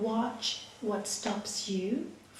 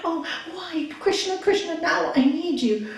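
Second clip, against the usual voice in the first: raised, anguished plea, fast at first; screaming at God; 6 semitones higher, 4 dB louder than usual. Acted. Husband who lost his family crying out to God, anguished. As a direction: cry out to God in despair. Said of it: "he's screaming".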